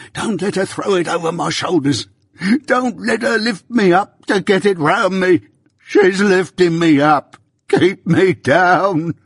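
high and loud, slowing into a dragged-out death plea; desperate terrified screams. Acted. Dying Arthur begs them to hold him down, desperate screams. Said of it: "and screams out".

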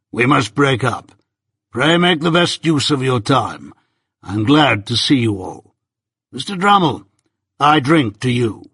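measured toast-giving manner; Jaggers, formal host. A narrator's reading. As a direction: address the table with deliberate courtesy.

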